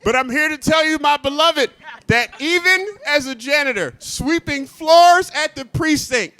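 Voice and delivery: mock preacher cadence. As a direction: preach it from the pulpit. Preacher-style address, my beloved.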